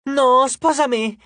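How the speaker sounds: high, loud fangirl cry; shouting. A narrator's reading. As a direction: shout it high, competing for his attention. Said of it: "gridò un'altra".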